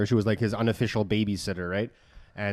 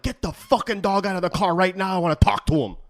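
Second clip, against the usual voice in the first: higher, furious order; furious demand; 7 semitones higher than usual. Retold or imitated. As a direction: bark at them to bring the dog out. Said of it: "And I'm like".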